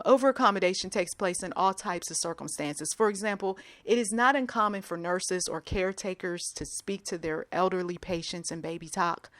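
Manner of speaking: ordinary delivery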